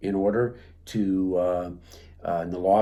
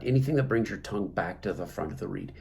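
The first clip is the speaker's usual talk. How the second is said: usual speaking voice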